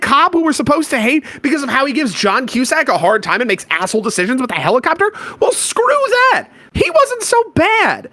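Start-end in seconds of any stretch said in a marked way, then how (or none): 5.4-8.1 defiant. Real, not acted. Defiant reversal about cop.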